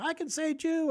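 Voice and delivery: high-pitched mimicked protest; mock self-justification. Mimicking gentiles justifying themselves.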